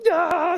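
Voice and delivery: high, loud victory roar; a warrior's wild cry of triumph; triumphant yell. Retold or imitated. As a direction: roar it out like Braveheart with the head held up. David hauls up the head.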